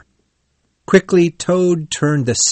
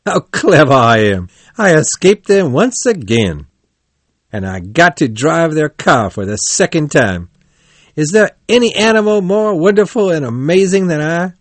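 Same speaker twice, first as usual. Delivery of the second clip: gleeful boasting; 6 semitones higher, 4 dB louder than usual. A narrator's reading. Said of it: "Then he began to laugh".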